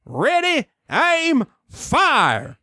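drawn words, starting high and dropping on the last; firing command. Acted. Commander ordering the cannons fired, mock military command. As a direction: bark a mock military countdown.

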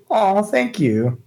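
high, drawn-out sweet delight; acted chipper gratitude; really chipper. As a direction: gush slowly at a compliment. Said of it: "like, really chipper".

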